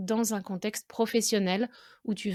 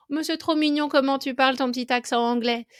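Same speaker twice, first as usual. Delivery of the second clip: higher-pitched, lightly cooing imitation; put-on "how adorable" voice, pitched up; mock gushing; 4 semitones higher, 4 dB louder than usual. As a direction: imitate people fawning over something cute. Quoting people cooing over his accent.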